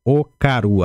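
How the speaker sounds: "Rae okaru" slowly and clearly, then "Okaru" again; teacher spelling out "okaru" with the voice; exact pronunciation demo. Shown as a demonstration. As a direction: model "okaru" slowly for repetition. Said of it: "A pronúncia é exatamente assim".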